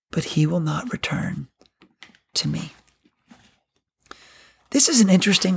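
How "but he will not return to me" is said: soft, voiceless whisper; hushed whisper. Retold or imitated. Preacher reading David's grief in a hushed whisper. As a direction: let it fall away into a whisper. David's lament quoted, trailing.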